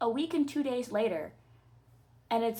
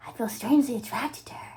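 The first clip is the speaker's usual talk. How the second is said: whisper; 2 semitones higher, faster than usual. Acted.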